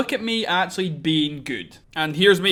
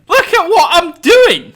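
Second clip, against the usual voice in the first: slow, much higher and louder outcry; exasperated outcry; 12 semitones higher, 13 dB louder, slower than usual. Real, not acted. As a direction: despair at your own mess, loudly. Exasperated at his own build.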